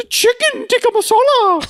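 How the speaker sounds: very high, hammed-up dish announcement; waiter impression. Waiter echoing an order at the table, hammed-up high voice. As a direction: call out the dish in a comic high voice. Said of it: "and then he goes".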